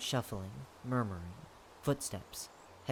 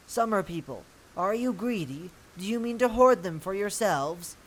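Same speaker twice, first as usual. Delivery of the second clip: higher, indignant accusation; indignant challenge; 6 semitones higher, 4 dB louder than usual. Acted. A rival voice accuses the summer people of greed, indignant. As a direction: challenge them before the whole crowd.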